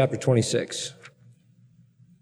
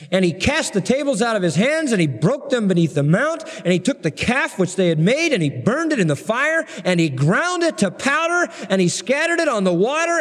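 fervent scripture reading; 8 semitones higher than usual. Acted. Preacher reads the angry Moses passage.

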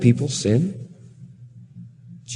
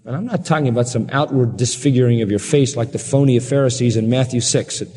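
normal talking voice

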